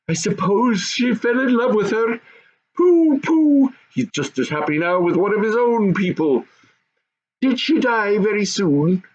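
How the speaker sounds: high goblin gossip; goblin family voices. Acted. Goblin parents and son gossiping about the queen, high. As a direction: chatter high like goblins at home.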